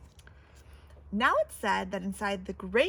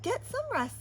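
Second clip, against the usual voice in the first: nursemaid's voice; 11 semitones higher than usual. Acted. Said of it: "said Madge".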